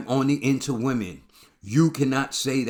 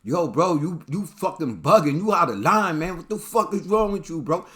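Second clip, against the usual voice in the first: mock angry outburst; 6 semitones higher, 3 dB louder than usual. Acting out [name]'s angry reaction.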